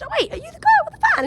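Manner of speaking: high, quick excited squeak; excited recognition. Retold or imitated. Recognising the girl with the van, excited squeal. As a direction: blurt it out in a high voice.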